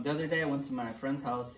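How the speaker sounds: usual speaking voice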